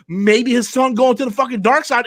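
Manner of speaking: ordinary talk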